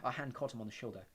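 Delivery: plain reading voice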